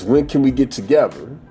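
ordinary talk

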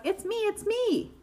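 high, drawn-out duck voice; slowly singsong the reveal, high. A narrator's reading. Unmasked duck to the guessers, high-voiced reveal. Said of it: "says the duck".

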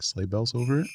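ordinary talk

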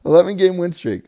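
laughing disbelief at the streak; laughing mock awe. Real, not acted. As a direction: marvel at it while laughing.

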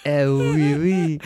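hammed-up French accent; put-on French lilt; mock French accent. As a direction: play at being French, teasingly. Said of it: "now let the accent commence".

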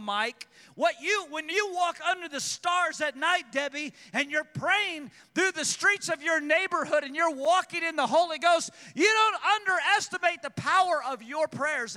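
high, loud shouting preacher; preaching at full force. Acted. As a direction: cry it out to the congregation, high and loud.

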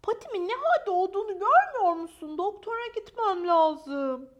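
high, loud, plaintive protest; indignant complaint. Acted. Maya tells Rex about her paw, high indignant complaint. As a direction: protest your suffering in a high voice.

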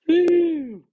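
high, loud "woo"; excited whoop. Real, not acted. Fantasy host marvels at his playoff lineup, excited whoop.

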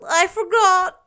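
put-on high, loud childish whimper; a small boy pleading his excuse. A narrator's reading. Davy excuses himself to Marilla, high whimpering boy. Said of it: "whimpered Davy".

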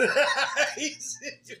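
big high burst of laughter; loud laugh. Real, not acted. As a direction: crack up loudly at your own joke. Mocking jobless folks, cracking up.

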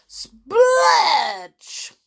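high, loud splattering burst; splurge effect. A narrator's reading. Foam spraying over everyone, explosive sound word.

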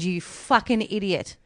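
ordinary delivery

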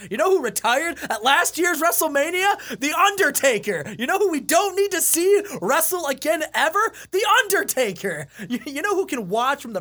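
mounting fired-up rant; building rant. Real, not acted. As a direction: escalate the rhetorical questions. Building rant, rising each time.

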